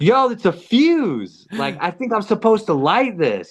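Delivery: high, animated retold exclamation; excited discovery. Storyteller acting out telling his friend about the fuse, excited.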